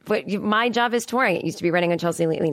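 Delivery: ordinary talk, nothing marked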